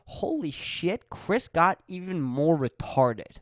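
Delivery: imagined listener's hammed-up shock; mock astonishment. Podcast listener reacting to the host getting dumber, mock astonishment.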